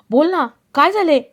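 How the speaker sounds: impatient son demanding an explanation; insistent demand. Acted. Son presses mother for answer.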